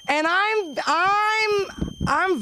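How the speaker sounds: high, flustered squeak on I'm; squeaky stammer. Real, not acted. Comedian squeaking nervously before defending herself. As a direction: catch your breath on a high I'm.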